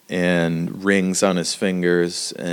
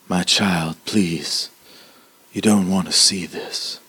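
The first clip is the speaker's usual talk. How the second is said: lightly voiced gentle old man; a hint of an old man's gentle caution. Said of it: "he's very gentle".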